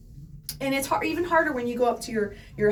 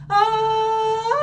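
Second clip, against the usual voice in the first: register click; 10 semitones higher, 7 dB louder than usual. Shown as a demonstration. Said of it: "you hear that little click?".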